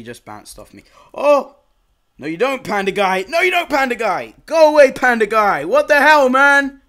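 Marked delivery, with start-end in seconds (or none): 1.1-6.8 much higher, louder shouting; furious gamer tirade; angry yelling. Real, not acted. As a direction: yell at Panda Guy to back off. Chased by Panda Guy.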